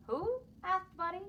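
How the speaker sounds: high, surprised question; Buddy doesn't catch it, asks high; puzzled who. Acted. Confused little rabbit to father, high and surprised.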